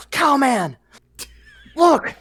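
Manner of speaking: put-on high excited little girl calling out; excited child voice. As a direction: call out like an eager kid.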